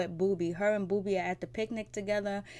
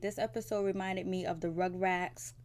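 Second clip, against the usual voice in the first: normal talking voice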